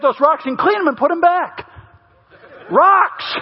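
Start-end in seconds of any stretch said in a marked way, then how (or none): 2.7-3.4 incredulous exclamation. Real, not acted. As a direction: throw the word out in disbelief. Incredulous exclamation about rocks.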